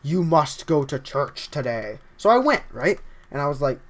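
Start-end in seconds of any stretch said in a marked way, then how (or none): 0.0-2.0 low, slow, solemn command; mysterious calling voice. Acted. Voice on the phone summoning a man to church, solemn and slow. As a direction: intone it like a summons from beyond. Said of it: "and it said".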